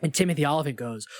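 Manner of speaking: usual speaking voice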